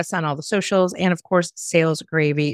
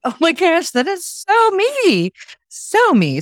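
high, slow gushing delight; excited squeal; 12 semitones higher, 4 dB louder, slower than usual. Retold or imitated. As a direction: gush like you just saw yourself on screen. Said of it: "And I'm like".

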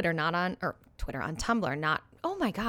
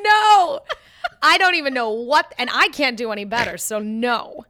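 loud, high, laughing rejection; laughing outburst; 4 semitones higher, 10 dB louder than usual. Real, not acted. Reacting to a friend's failed accent, loud and laughing. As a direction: burst out in amused protest, pitched up.

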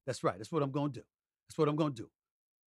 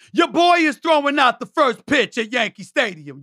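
high, loud hollered brag; shouted announcement; 12 semitones higher, 14 dB louder than usual. Real, not acted. Host telling fans about the first pitch, shouted announcement. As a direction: belt out the big news.

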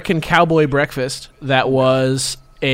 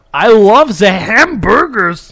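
mock German accent; 5 semitones higher, 6 dB louder, much slower than usual. Co-host joins the mock scientist bit.

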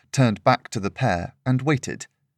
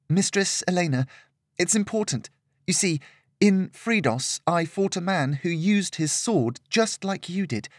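put-on higher, earnest girlish voice; pleading young girl; 7 semitones higher than usual. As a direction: plead urgently, afraid of the man. Lillian pleads with Elena.

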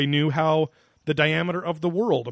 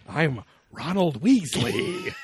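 Ronald Weasley voice; 4 semitones higher, much slower than usual. Pretend. Joking Harry Potter riff.

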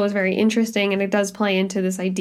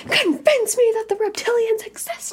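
high, mocking challenge voice; skeptic's challenge; 13 semitones higher than usual. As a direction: dare them like a stubborn skeptic. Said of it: "I'm gonna be the guy on the lawn".

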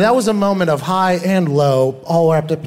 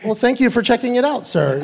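1 mock polite reply; 6 semitones higher than usual. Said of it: "And I was like".

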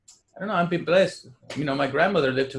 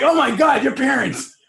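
high, loud appalled exclaim; loud mock shock; 11 semitones higher, 9 dB louder than usual. Friends exclaim at strict parents, high and loud. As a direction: gasp at those parents.

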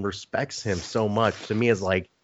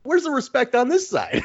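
pitched way up, needling a friend; hammed-up teasing, higher; ribbing high voice. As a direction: rib him in a high mock voice. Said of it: "I go".

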